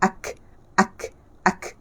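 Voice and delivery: "ak" stopped firmly, short puff; syiddah letter. Shown as a demonstration. Teacher repeats the syiddah letter, stopped "ak". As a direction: shut off "ak" before the brief airy release. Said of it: "Dia adalah huruf syiddah".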